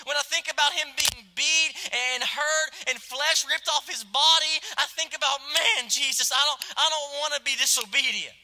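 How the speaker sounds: fervent sermon climax; full-force preaching. Acted. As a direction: drive it home from the pulpit.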